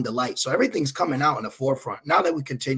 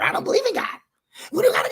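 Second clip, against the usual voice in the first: mocking unbeliever; faster than usual.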